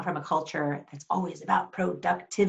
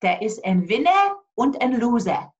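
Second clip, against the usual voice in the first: put-on higher, louder German-accented voice; mother's German accent; 6 semitones higher, 6 dB louder than usual. Mother consoling her daughter after a lost match, German accent. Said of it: "She has an accent".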